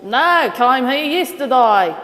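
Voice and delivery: put-on punchline in a broad Australian accent; Aussie bloke. Aussie answering the officer, loud Australian punchline. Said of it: "the Aussie responds".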